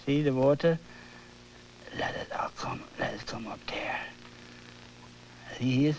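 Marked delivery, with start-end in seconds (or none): 2.0-4.0 breathy whisper to himself as he paints. Real, not acted. Painter whispering to the canvas, coaxing the paint. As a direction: hush it, coaxing the water in.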